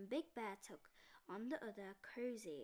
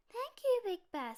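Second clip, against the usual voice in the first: little bear cub; 12 semitones higher, 10 dB louder than usual. A narrator's reading.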